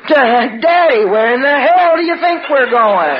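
high, cross retort; exasperated mother. Retold or imitated. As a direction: answer a child's question with exasperation. Mother snapping at child's question.

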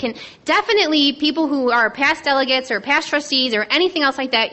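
usual speaking voice

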